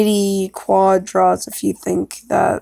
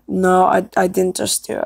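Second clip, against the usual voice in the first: usual speaking voice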